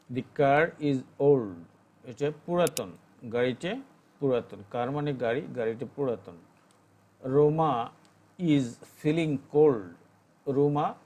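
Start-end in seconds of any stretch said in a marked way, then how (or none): 0.1-1.5 slow and louder model reading; slow dictation. Shown as a demonstration. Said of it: "teacher reads English example". 7.2-9.9 slow, loud word by word reading; teacher's slow model reading; slow dictation. Shown as a demonstration. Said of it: "teacher reads English example".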